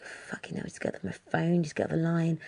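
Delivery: soft, breathless mutter under the breath; muttered curse. Retold or imitated. Imagining the frustrated support agent cursing the caller, muttered softly. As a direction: mutter it like a fed-up agent to himself. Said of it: "I think he was just like".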